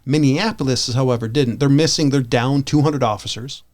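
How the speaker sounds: ordinary talk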